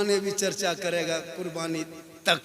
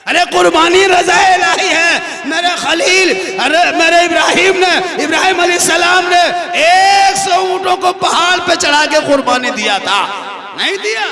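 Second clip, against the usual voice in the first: loud, high sermon proclamation; full-force preaching; 10 semitones higher, 14 dB louder than usual. Acted. Preacher proclaiming Ibrahim's sacrifice to the congregation, loud and high. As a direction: proclaim it to the whole mosque.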